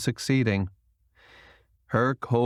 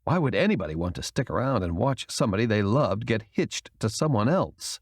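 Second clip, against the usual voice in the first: ordinary delivery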